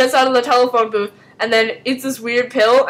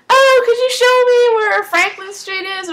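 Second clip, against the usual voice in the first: high, put-on girlish request for directions; high-pitched mock innocent question; mock helpless asker; 11 semitones higher than usual. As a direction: ask it sweetly in a raised voice. Acting shapeshifter asking for directions.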